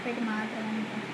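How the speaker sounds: barely audible, choked back; one soft word after crying; quiet tearful reply. Acted. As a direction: mumble it through held-back tears. Tearful girl hiding her crying.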